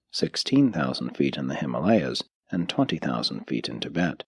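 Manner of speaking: plain reading voice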